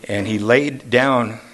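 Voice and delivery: ordinary talk, nothing marked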